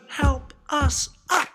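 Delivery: a squeaky letter calling for help; mock cry for help. Letter A cries out from the pile, squeaky. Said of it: "cried A".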